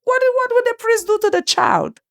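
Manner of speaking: high-pitched imitation of trusting onlookers; mock naive questioning. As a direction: put on a high, innocent questioning voice. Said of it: "They are going to say".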